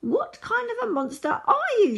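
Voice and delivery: high-pitched outraged shout; narrated shout. A narrator's reading. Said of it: "shouted Rabbit".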